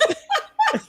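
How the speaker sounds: squealing laugh; high squeal of laughter. Real, not acted. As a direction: shrieks with laughter. Reacts to a funny sketch.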